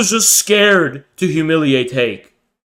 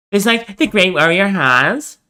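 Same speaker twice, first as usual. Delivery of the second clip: mocking Vosh; 5 semitones higher than usual. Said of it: "He sounds like fucking bad empanada".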